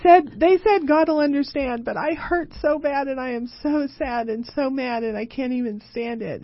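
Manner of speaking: mock distress. Herself voiced to husband, upset.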